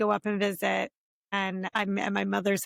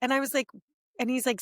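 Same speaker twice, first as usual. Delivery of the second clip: usual speaking voice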